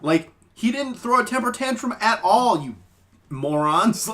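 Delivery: mock-furious disbelief at the commenters; high-pitched incredulous rant; exasperated outburst. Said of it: "I'm like".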